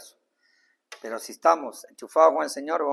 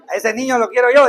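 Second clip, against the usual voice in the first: a loud, proud parent; pleased parent; 7 semitones higher, 9 dB louder than usual. Retold or imitated. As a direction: announce your pride loud. Padre satisfecho. (padre orgulloso en voz alta)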